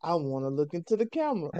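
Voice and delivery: a quiet mock-tempted "I wanna look"; sneaky urge. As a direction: let the naughty urge slip out quietly. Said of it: "Then you like".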